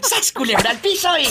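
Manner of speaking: high called catchphrase. Acted. Host calling the catchphrase to the caller, high. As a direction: belt the show's catchphrase.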